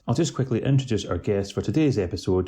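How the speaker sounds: loud, projected show opener; podcast host introduction. Acted. Host welcoming listeners to a new podcast, loud announcer delivery.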